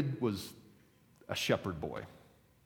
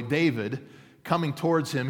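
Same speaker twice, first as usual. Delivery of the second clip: ordinary talk, nothing marked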